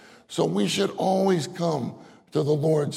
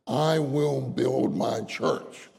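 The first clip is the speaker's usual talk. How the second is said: slow quoting; 2 semitones lower, much slower than usual. Pretend. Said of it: "the Lord says".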